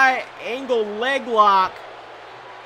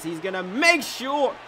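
ordinary delivery

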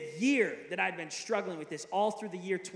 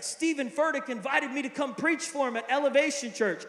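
imitated excitement; 6 semitones higher, 5 dB louder than usual. Retelling a friend's excited news.

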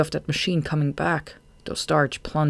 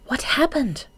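high-pitched, urgent asking; anxious question; 10 semitones higher than usual. A narrator's reading. Marina asks shaking Shade after their escape, anxious. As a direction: jump up high with concern.

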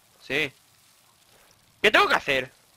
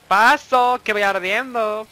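loud, slow put-on cry; hammed-up alarm; 4 semitones higher, 6 dB louder, much slower than usual. Gamer running through flames, hammed-up cry. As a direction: play the burning hero, drawn out.

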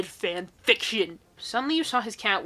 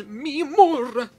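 slow, louder, stretched mi amor; hammed-up seductive; 5 semitones higher, 8 dB louder, slower than usual. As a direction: ham up the lover's murmur. Mafia king's tender line.

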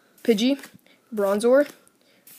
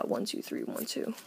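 whispered counting; 13 dB quieter than usual. Real, not acted. Counting cards under breath.

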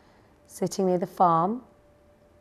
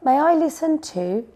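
high-pitched eager little chick; eager request; 8 semitones higher, 4 dB louder than usual. A narrator's reading. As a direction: ask eagerly to join in. Eager chick asks to join.

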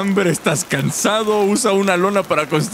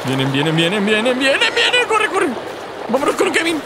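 rising panicked calls; being chased; 8 semitones higher than usual. Pretend. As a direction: panic as they close in. Fleeing monkeys in game.

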